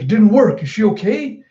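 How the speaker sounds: high-pitched worried exclamation; retold worried cry; mock alarm. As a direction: act out alarmed concern. Luffy alarmed for friend.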